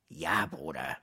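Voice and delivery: low, soft, falling reply; resignierte Antwort. A narrator's reading. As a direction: give in with a low, weary answer. Prisoner answers caller again. (tiefe, leise, ergebene Antwort)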